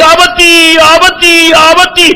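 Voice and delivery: put-on high, drawn-out wail of a buried child; child's terrified cry. Little daughter screams for her father as earth falls, wailing. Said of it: "என்று அலறிய அலறல்".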